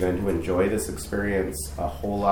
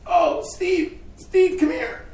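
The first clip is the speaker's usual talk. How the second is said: much higher, calling across a parking lot; lady calling out; 3 dB louder, much slower than usual. Retold or imitated. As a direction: hail him brightly from a distance. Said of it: "and this lady".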